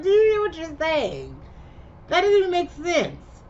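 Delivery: high, exasperated disbelief; you can't believe what she just said; incredulous disbelief. Retold or imitated. Viewer reacting to absurd line.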